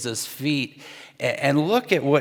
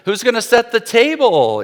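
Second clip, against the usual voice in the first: higher, louder pointed question; passive-aggressive; 9 semitones higher, 7 dB louder than usual. Retold or imitated. Martha calling out pointedly to her sister, passive-aggressive. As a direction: call it out so the sister hears. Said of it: "passive-aggressive thoughts".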